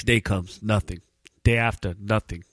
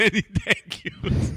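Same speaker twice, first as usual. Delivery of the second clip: slow, higher mock Indian agent through laughter; laughing mock accent; 10 semitones higher, much slower than usual. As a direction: repeat the punchline, cracking up. Friend repeats the mock line.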